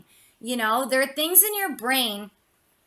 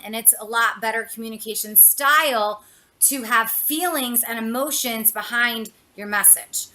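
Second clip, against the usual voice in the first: ordinary talk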